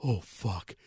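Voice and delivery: low, soft oh fuck; quiet guilty realization; retold dismay. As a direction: let it sink out quietly on realizing the mistake. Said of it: "and he was like".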